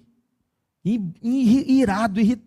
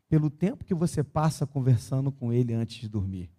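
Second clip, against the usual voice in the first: soft, low, tender preaching close to tears; pregação terna; 7 semitones lower, 6 dB quieter than usual. Acted. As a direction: speak quietly and let the emotion sit. Narração final terna e baixa. (pregação terna, mais baixa e suave)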